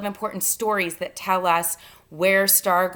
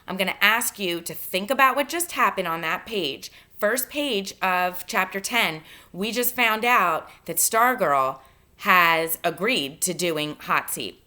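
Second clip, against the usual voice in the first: usual speaking voice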